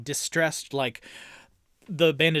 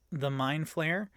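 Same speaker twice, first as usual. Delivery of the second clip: ordinary talk, nothing marked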